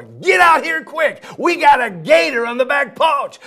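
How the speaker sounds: high, loud urgent shout; dog raising the alarm about the gator; urgent call. Acted. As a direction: call the master out fast. Dog's bark meaning, calling out.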